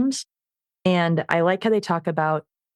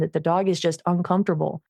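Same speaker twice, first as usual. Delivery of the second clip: normal talking voice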